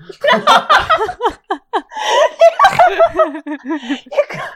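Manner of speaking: loud burst of high laughter; helpless laughter. Real, not acted. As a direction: crack up completely, high and long. Cracks up at cat reveal.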